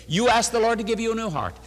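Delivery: usual speaking voice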